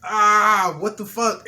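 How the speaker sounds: shocked loud outburst; panicked yell. Retold or imitated. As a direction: yell it at the burning stove. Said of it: "I'm like".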